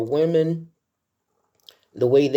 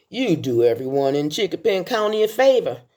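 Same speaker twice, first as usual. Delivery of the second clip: egging on; 7 semitones higher, faster than usual. Retold or imitated. Quoting a film's funeral scene.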